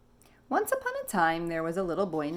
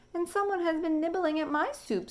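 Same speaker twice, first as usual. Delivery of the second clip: higher, calm mama dinosaur; 11 semitones higher than usual. Acted. Mama noticing her nibbled soup, calm. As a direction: say it evenly, like an unruffled mother. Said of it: "said the mama in her calm voice".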